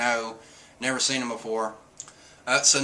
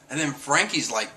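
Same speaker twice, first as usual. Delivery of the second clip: usual speaking voice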